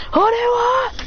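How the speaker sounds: squeaky, high-pitched challenge; high yelled question. Retold or imitated. Kid retelling a fight, villain yells up high.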